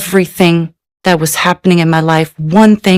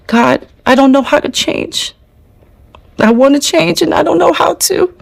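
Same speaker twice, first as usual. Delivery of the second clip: higher voice rising in a tearful plea; retold crying, pitched up and helpless; crying prayer; 6 semitones higher than usual. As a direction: plead with God, voice climbing as if near tears. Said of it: "and I was crying".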